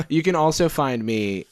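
normal talking voice

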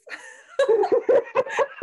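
a burst of high, loud laughter; can't stop laughing out loud. Real, not acted. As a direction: cackle high at the memory. Laughing at her own story.

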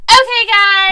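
loud, hyped YouTuber intro; clickbait video narrator. Acted. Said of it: "I'm gonna shout out my YouTuber voice".

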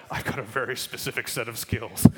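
loud, grim action-hero threat; Liam Neeson mode. Retold or imitated. Pastor on the playground doing Liam Neeson menace. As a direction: growl it like a movie hitman. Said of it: "full Liam Neeson mode".

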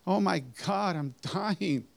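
rising high, desperate cries to God; frantic pleading. Retold or imitated. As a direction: beg for your life as you fall. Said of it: "And I started screaming".

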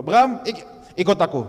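loud, commanding call from God; booming call. Shown as a demonstration. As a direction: thunder the summons. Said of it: "Coba kalau". (panggilan Tuhan yang menggelegar)

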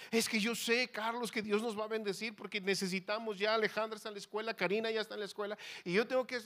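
high, loud, run-on pleading; pleading wife. Retold or imitated. Preacher mimics his wife arguing for the car, insistent pleading. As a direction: imitate a wife piling up reasons without stopping.

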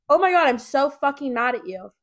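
loud mock outburst at a stranger; retold yell. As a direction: snap it out like a half-joking yell. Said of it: "so I yelled at him".

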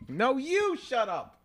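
high, drawn-out comeback; playful high-pitched, stretched-out retort; mock retort. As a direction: toss it back slowly in a high voice. Mock retort to co-host's shut up.

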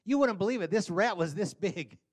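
fast, loud, high bragging; excited fish story. Acted. A guy boasting to friends, fast excited brag. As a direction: brag breathlessly about a giant rat. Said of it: "He's saying".

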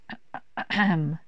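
small polite throat clearing. Acted. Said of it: "Kenny cleared his throat".

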